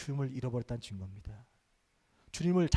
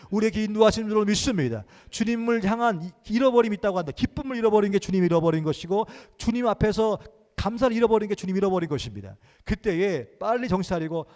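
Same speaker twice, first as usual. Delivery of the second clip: loud, raised preaching cry; preaching at full force; 5 semitones higher, 9 dB louder than usual. Acted. Pastor proclaiming faith to the congregation, full-force preaching.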